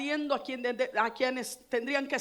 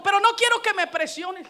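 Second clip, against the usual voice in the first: mother's protest; 6 semitones higher, 7 dB louder than usual. Retold or imitated. Mother's retort acted out.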